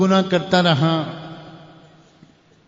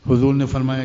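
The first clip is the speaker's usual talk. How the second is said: ordinary delivery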